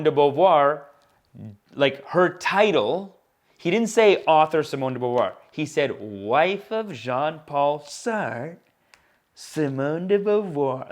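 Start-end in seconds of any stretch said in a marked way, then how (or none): 6.0-10.9 drawn-out, stanky sneer at the name. Retold or imitated. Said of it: "he said it, like, stanky like that".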